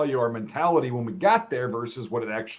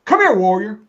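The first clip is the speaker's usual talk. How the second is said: a mock call, pitched higher and louder; imitating a shouted summons; 8 semitones higher, 8 dB louder than usual. Sergeant calling the soldier back, mock loud call. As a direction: hail him like a pushy sergeant. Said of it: "so he goes".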